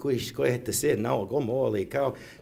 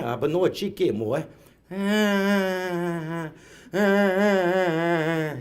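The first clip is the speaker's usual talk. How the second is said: high wailing little kid, put-on; mock a toddler's tantrum wail; hammed-up child crying; 4 semitones higher, much slower than usual.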